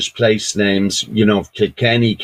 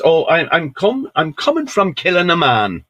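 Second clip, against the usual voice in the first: put-on higher-pitched young fella's voice; young fella answering; 7 semitones higher than usual. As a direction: stammer out an innocent answer, pitched up. Young fella answers soldier in story.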